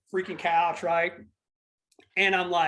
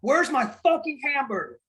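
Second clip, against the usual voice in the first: put-on drunk demand; 6 semitones higher, 3 dB louder than usual. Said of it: "And then she goes".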